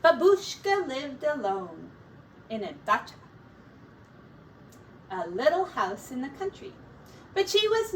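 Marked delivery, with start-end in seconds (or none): none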